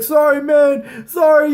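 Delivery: high, loud, slow "sorry, man, sorry"; shouted apology. Retold or imitated. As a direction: yell the apology across the street. Guy yelling apology during fight.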